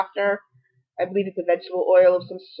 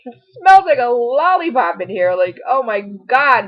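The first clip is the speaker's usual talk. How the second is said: higher, slower, put-on outraged young guy; imitating the boyfriend complaining about the sweet smell; mock disgusted boyfriend; 4 semitones higher, 5 dB louder, slower than usual. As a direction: ham up his shock at the smell. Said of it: "my daughter's boyfriend was like".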